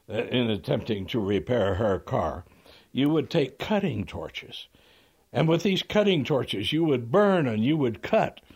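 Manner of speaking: hammy lawyer working himself up; building mock shout. Lawyer grilling the repairman to make the lady cry, building mock shout. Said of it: "he's almost shouting now".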